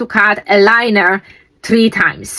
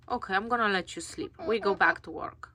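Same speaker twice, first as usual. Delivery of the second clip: hushed; 18 dB quieter, faster than usual. Real, not acted. Leaving sleeping pet.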